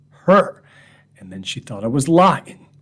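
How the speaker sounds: usual speaking voice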